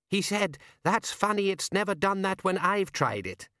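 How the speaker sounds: high voice telling a punchline. A narrator's reading. Woman retelling punchline.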